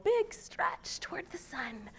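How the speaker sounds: put-on soft breathy whisper to the children; hushed whisper at the end of the rhyme. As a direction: whisper it like a secret. Fingerplay rhyme, stretching toward sun.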